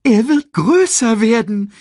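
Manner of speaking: high, very loud meerkat retort; exasperated meerkat. Acted. Meerkat warning warthog the cub will grow, high emphatic voice. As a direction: drive the point home high and loud. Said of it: "erklärte Timon".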